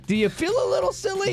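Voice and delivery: high teasing "feel silly". Retold or imitated. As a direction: tease them gently. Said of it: "you're like".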